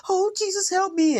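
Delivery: very high, drawn-out mock wail; mock crying plea. Man hiding in the closet begging Jesus, high mock-crying wail. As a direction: imitate someone terrified, wailing for help.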